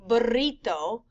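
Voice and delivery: burrito with a Spanish double R; double R demo. Shown as a demonstration. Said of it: "a single R sounds different than a double R".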